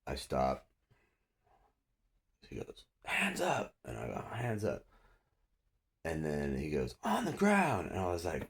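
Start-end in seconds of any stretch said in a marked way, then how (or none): 3.0-3.7 very high mock cop yell; imitating officer's command. Cop repeating order to suspect, high imitated yell. 4.3-4.8 slightly raised pitch, no yell; retold compliant echo. Suspect answering the cop's order, mild retold echo. His own compliant reply to officer. 7.0-7.9 high-pitched mock cop shout; imitating officer's command. Cop ordering suspect down, high imitated shout.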